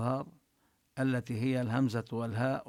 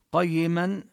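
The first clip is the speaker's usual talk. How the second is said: ordinary talk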